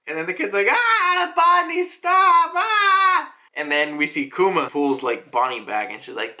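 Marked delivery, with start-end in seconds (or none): 0.7-3.3 put-on high, drawn-out wailing kids; stretched, high comic screaming; mock panicked scream. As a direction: shriek for mercy like a child. Kids scream as Bonnie attacks.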